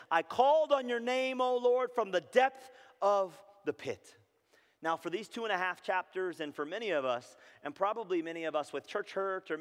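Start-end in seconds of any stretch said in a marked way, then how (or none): 0.1-4.1 loud, high plea slowing at the end; impassioned scripture cry. Acted. Preacher voicing Jeremiah all cried out, impassioned loud cry. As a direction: call it out to heaven. Cries out the verse again.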